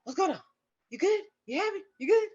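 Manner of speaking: high, quick-fire checking questions; worried husband. Retold or imitated. Husband running in to check on labouring wife, high and urgent. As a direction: imitate a husband rushing in concerned.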